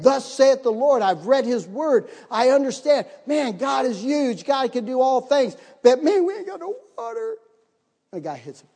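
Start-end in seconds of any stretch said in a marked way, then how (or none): 0.0-1.0 slow, loud, higher preacher intoning; solemn proclamation. Shown as a demonstration. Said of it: "I read". 5.8-7.4 much higher, squeaky cry of alarm; mock panic. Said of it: "panic after God's greatness".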